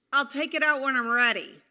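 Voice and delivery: put-on sassy kid retort. Acted kid talking back.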